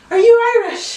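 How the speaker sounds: high, loud quizzical voice; mock curious stranger; mock English asker. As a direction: play a puzzled Londoner. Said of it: "when we travel".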